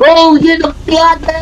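high, frightened rapid cries; panicked. Real, not acted. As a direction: panic at being cornered. Cornered by monster.

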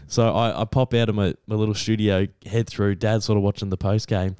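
ordinary delivery

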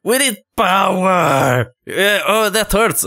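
hammed-up strain; straining cry. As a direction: voice the effort of pushing hard. Voices strained character pushing.

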